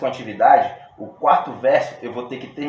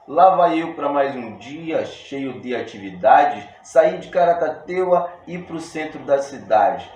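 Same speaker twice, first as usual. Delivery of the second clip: teacher declaiming his own poem to the class; reciting verse; 3 semitones higher, 5 dB louder than usual. Shown as a demonstration. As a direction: recite it like a cordel poet on stage. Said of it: "Ó, minha primeira estrofe desse meu texto".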